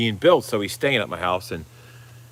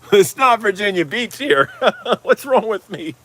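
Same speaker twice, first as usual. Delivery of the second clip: higher, laughing through the words; laughing at himself; 11 semitones higher, 4 dB louder than usual. Real, not acted. To viewers after a place-name slip, laughing. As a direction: laugh off the mistake.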